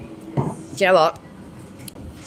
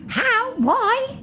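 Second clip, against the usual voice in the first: much higher, drawn-out what the fuck; stunned, can't believe what he's reading; shocked disbelief; 14 semitones higher, much slower than usual. Real, not acted. Shock at prisoner's release news.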